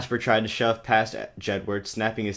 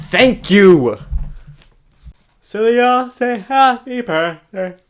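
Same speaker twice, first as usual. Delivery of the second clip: loud, much higher, sing-song; mock cheer; 12 semitones higher, 12 dB louder, slower than usual. Real, not acted. Reader sarcastically celebrating, shrill mock cheer. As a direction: gush sarcastically.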